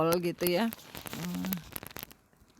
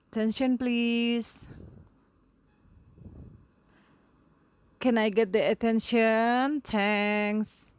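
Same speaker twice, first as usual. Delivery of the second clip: teacher calling class; 5 semitones higher, 4 dB louder, slower than usual. Shown as a demonstration.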